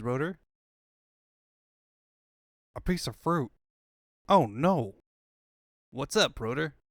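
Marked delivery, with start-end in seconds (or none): 4.3-4.9 loud alarmed cry. Acted. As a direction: gasp it out in alarm. Alarmed cartoon outburst.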